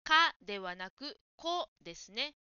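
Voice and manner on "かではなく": か said louder as the error; emphatic か against こ; stressing the wrong sound. Shown as a demonstration. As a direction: point out the か sound. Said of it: "カンサートと言っている".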